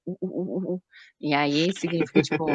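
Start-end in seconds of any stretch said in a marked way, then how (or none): 0.1-0.8 soft uh pulses through pursed lips, much softer; quiet little monkey hoots. Shown as a demonstration. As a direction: hoot through a pout like a wary spider monkey. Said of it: "eles fazem o biquinho e fazem".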